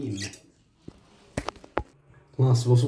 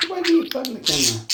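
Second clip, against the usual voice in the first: pet talk; 12 semitones higher, faster than usual. Real, not acted. Talking to budgie on camera.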